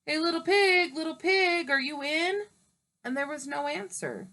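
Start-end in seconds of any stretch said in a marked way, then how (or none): 0.0-2.5 higher, louder call to the pig. Acted. Wolf calling into the straw house, mock call. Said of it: "so I called".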